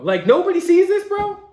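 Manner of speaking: loud, high-pitched disbelief; incredulous. Real, not acted. Host baffled that nobody sees this, incredulous. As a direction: ask it in high, exasperated disbelief.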